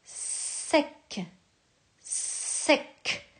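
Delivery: "Sec" whispered, the s hissed; whispered "Sec" for a pupil; whispered dictation. Shown as a demonstration. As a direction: breathe out "Sec" without voice. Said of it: "tu vas écrire la syllabe sec".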